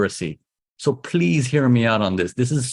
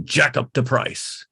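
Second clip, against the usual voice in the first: slow, softer, drawn-out jack up the price; knowing sly tone; 3 dB quieter, slower than usual. Shown as a demonstration. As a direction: savour it like a greedy seller. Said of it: "You gotta get this tone in your head".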